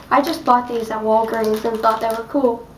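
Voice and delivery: ordinary talk, nothing marked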